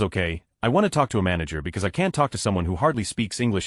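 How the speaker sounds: plain reading voice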